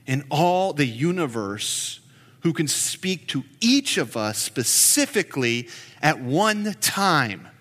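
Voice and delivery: low, soft, unhurried prayer to the Lord. Real, not acted. Pastor praying softly to God before the sermon, reverent. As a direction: pray quietly and reverently.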